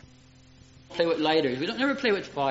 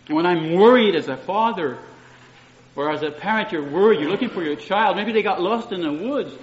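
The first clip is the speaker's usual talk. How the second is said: ordinary talk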